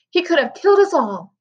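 put-on outraged old woman, only moderately loud; narrated cry of indignation; mild scream. A narrator's reading. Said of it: "screamed Grandma Josephine".

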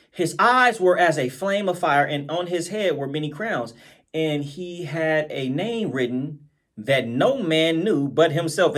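slow, low reading voice; preacher reading from the Bible; solemn scripture reading. Acted. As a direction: read the verse slowly and weightily.

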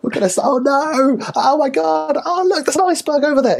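very high, hammed-up terrified squeal; mock panic. Client panicking to the coach about sinking, mock panic. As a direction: squeal like a comic passenger on a sinking ship. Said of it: "And they're like".

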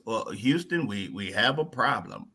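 low, soft mock astronaut report; pilot radio voice. Pilot reporting the problem to Houston, low and soft. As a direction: call it in like a pilot in trouble.

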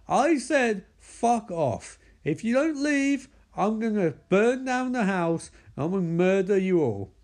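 high-pitched hammed-up rage; mock furious threats. [name] threatens to burn down the house, mock furious. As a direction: act out an escalating angry ultimatum.

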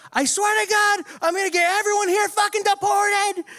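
high-pitched re-enacted raging yell; acted yelling. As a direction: holler it at everyone, voice pushed way up high. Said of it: "yelling at the top of my lungs".

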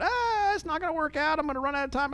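high, soft fretting agent; mock panic. Coach imitating a worried agent with high, soft mock panic. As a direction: fret in a thin, high voice like you're sure it'll fail. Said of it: "don't get all twisted up about".

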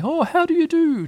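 high, sing-out Owl hello; hooting greeting. Acted. Owl flies up and greets friend.

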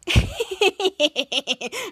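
long staccato giggle; imitated giggle. Schwartz watches Ray fall, acted giggle. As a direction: put on a mocking giggle.

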